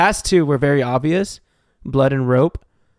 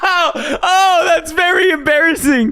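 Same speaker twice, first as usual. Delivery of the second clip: embarrassed laughter; 11 semitones higher, 5 dB louder than usual. Real, not acted. Embarrassed laughing after the mistake.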